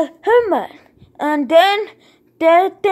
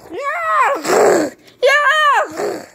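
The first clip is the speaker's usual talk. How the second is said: high cheering yell, rising higher; a kid's pretend victory cry; triumphant shout; 8 semitones higher than usual. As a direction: cheer it out like you just won.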